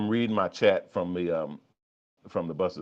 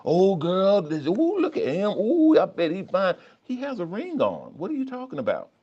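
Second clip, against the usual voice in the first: gossiping woman voice; 11 semitones higher, 4 dB louder than usual. Pretend. Tempting voice talks about a married man.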